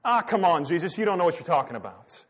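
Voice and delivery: mock brush-off, high then quick; dismissive retort. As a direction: imitate followers waving away a warning. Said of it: "what is their first response?".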